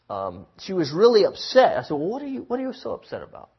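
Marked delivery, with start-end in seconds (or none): 1.9-3.5 quick concerned question. Retold or imitated. Counselor quotes himself.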